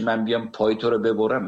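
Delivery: ordinary talk, nothing marked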